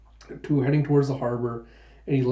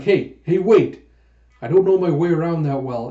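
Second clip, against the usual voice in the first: loud, higher call to stop him leaving; hey, wait, don't go; calling after someone; 3 semitones higher, 6 dB louder than usual. Retold or imitated. Huck calling after the leaving dragon, loud and higher.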